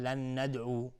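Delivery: "لن ندعو" ending on plain u, no wa; mistaken dropped fatha. Shown as a demonstration. Teacher imitates a common mistake, "لن ندعو" cut to final u. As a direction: stop "لن ندعو" on a long u. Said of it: "ممکنه بعضی تلفظ کنن".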